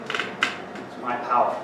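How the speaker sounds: ordinary talk, nothing marked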